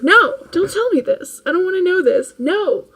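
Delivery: high, loud hammed-up refusal with a laugh; mock outrage at hearing it's healthy; mock protest. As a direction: play the horrified foodie, laughing. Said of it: "And he'll be like".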